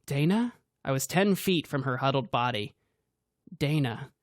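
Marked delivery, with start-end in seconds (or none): none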